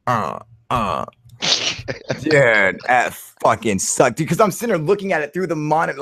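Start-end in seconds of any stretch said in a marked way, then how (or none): none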